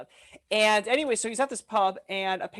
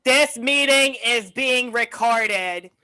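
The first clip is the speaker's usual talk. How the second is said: very slow, louder droning robot notice; annoying boomer voice; 3 semitones higher, 6 dB louder, much slower than usual. Retold or imitated. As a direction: drag each word like a stiff recording. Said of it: "the most annoying boomer voice".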